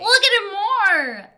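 high, slow, drawn-out shout; playful shouted order. Real, not acted. Co-host insisting friend look at Gary Busey, shouted order. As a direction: escalate into a loud comic demand. Repeats the demand, escalating.

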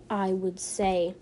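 normal talking voice

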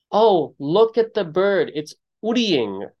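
put-on high, slow child's voice; a little kid pointing at a bird; quoting little cousin. Retelling a small cousin pointing at a bird, in a high childlike voice. Cousin's words quoted in story.

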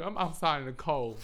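ordinary talk

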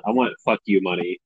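ordinary talk, nothing marked

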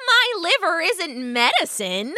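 high, indignant rabbit voice; protesting rabbit. A narrator's reading. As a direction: object in a small squeaky voice.

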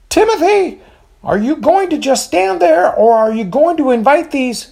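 high shrill scolding mother; sharp call to a dawdling son. A narrator's reading.

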